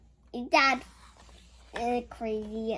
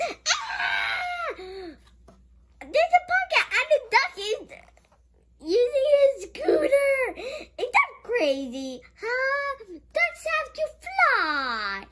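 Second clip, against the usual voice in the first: high, fast giggly excitement; laughing disbelief, much higher; excited laughing; 11 semitones higher than usual. Real, not acted. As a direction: squeal at the funny duck.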